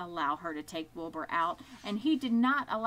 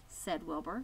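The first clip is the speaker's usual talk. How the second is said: plain reading voice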